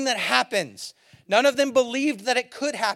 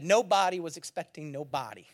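low, softer, reflective delivery; understated quote; 6 semitones lower, 6 dB quieter than usual. Retold or imitated. Preacher quoting another pastor to the congregation, understated. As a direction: let the line drop low and quiet.

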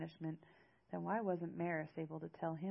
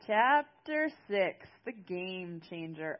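dramatic announcer; 9 dB louder, slower than usual. Pretend. Reader announcing chapter six to listeners, dramatic announcer. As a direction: boom out the title, stretching each word.

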